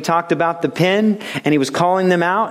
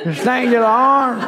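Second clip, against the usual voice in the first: mock Australian worship leader; 9 semitones higher, much slower than usual. American worship leader mocked putting on an Australian accent. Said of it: "trying to sing it like".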